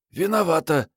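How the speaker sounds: put-on high girlish voice owning up; героиня книги винится. A narrator's reading. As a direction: apologise briefly, pitched high. Героиня винится перед командиром. (виновато, высоким девичьим голосом)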